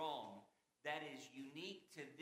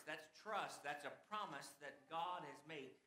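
ordinary delivery

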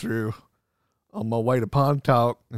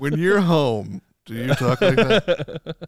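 slow, higher, playful teasing; teasing question; 5 semitones higher, 5 dB louder, slower than usual. Real, not acted. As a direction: tease her slowly about her accent. Teasingly asks about Southern accent.

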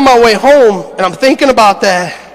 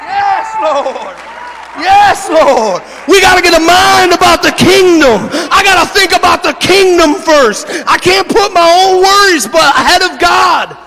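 full-force preaching; 8 semitones higher than usual. Acted. Pastor shouting to his congregation about the lost one. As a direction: preach it to the rafters, building fire.

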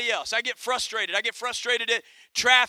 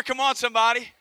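normal talking voice

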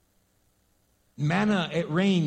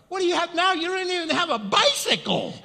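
mocking retort; 9 semitones higher, 3 dB louder than usual. Mocking reply to the bragger.

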